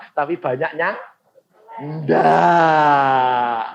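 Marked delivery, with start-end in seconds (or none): 1.8-3.6 loud, drawn-out crying no; mock wail. Congregation admitting they don't pray, mock crying no. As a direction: wail it like a sulking child.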